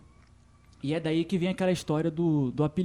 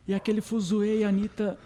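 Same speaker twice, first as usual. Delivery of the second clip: usual speaking voice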